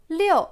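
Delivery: "Liu" loud, falling steeply from high; fourth tone. Shown as a demonstration. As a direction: drop "Liu" firmly from a high start. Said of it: "Quatrième ton".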